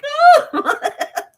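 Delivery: high, loud yelp into laughter; mock startled cry. Medium jumped at by excited people, mock startled yelp.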